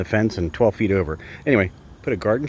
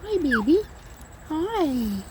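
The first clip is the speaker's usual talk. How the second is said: pet talk; much slower than usual. Real, not acted. Greeting the bird Tukey.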